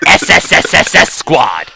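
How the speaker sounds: "S S S S S Squad" with a long hissed s; drawn-out s like a snake. Pretend. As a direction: stretch each "S" like a snake hiss. Stretched s like a snake.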